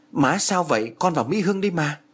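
high, panicky question; a son frightened for his mother; alarmed. A narrator's reading. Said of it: "hốt hoảng".

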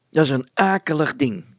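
decisive tone. A narrator's reading. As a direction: state it loudly and decisively. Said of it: "antwoordde Van Loon op besliste toon".

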